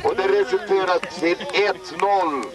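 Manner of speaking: rising excitement, high and eager as the goal comes; live play-by-play building to a goal. Acted. Commentator calling the first goal in the park, building excitement. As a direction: ride the attack up to the goal.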